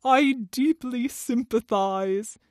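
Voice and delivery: slow, drawn-out mock sorrow; understated theatrical weeping; tearful walrus. A narrator's reading. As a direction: lament as a ponderous walrus. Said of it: "With sobs and tears".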